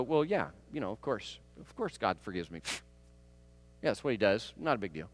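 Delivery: low, soft, dismissive mumble; offhand shrug. Retold or imitated. Preacher mimicking his own casual shrug at God's forgiveness, low and offhand. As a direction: brush it off like it's nothing. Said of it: "I go".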